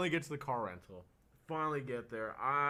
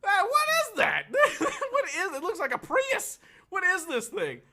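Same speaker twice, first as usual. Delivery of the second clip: much higher, louder, laughing; laughing disbelief; 10 dB louder than usual. Retold or imitated. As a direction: squeal in laughing horror at the car. Retold disbelief at the car.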